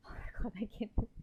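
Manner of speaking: breathless, overwhelmed whisper; can barely get the words out. Real, not acted. Player overwhelmed by character death.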